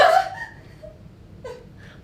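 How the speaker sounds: high, piercing mocking laugh; shrill mocking cackle. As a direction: cackle at her like a cruel mistress. Mocking the maid's weakness.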